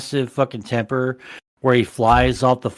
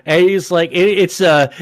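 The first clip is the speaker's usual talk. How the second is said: normal talking voice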